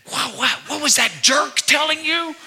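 a hint of a brash buddy; mocking teen buddy. Teen's buddy asking what the jerk said, lightly voiced brash teen.

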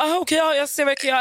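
high-pitched mock backpedalling; mock cowed agent. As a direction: squeak it like a cornered clerk.

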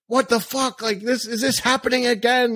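high-pitched exasperated disbelief; mock outraged neighbor. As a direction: act out a neighbor who can't believe it. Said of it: "Like, they were like".

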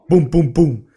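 loud punchy knocking noise; three heavy booms like fists on glass; imitated banging. Comic retelling of knocks on the window, loud imitated banging. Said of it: "bankar han på rutan tre gånger".

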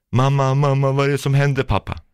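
a hint of a frightened child calling for parents; lightly voiced child. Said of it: "Jag hör ett ljud". (återberättad röst av oroligt barn)

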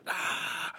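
soft, voiceless imitation of a monster's cry; breathy mock roar. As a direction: breathe out Godzilla's pained roar quietly. Imitates burnt Godzilla crying out.

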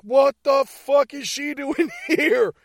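high, loud, drawn-out outrage; mock incredulous yell, stretched out slowly; outraged disbelief. [name] reacts to Danielle turning up, outraged.

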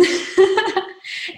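a real high, loud laugh; burst of laughter. Real, not acted. Guest laughing after admitting she broke the school rule, loud real laugh. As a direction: laugh out at your own confession.

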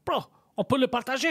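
high-pitched pushy French demand; mock street-shouter voice; imitated yelling. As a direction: mimic a stranger hollering for a share. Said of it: "'cause he was yelling".

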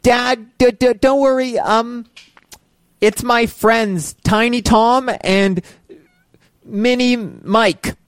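put-on high, slow little kid voice; sweet, innocent kid covering up. Child lying to dad.

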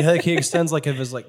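ordinary delivery

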